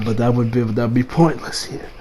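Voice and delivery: low, soft acted reasoning; calm dismissal. Retelling Jotaro explaining to frantic Josuke, calm low dismissal. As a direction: drop the voice low and quiet, unimpressed.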